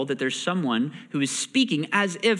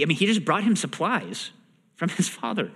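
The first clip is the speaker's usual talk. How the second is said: normal talking voice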